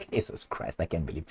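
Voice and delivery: breathy whispered aside; whispered Jesus Christ. Real, not acted. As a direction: drop to a whisper in disbelief.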